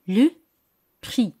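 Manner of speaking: Le prix with a punched Le and a French uvular r in prix; French vocabulary demo. Shown as a demonstration. Teacher models Le prix for her students, punching Le. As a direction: say Le prix crisply, hitting Le. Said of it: "La palabra el precio".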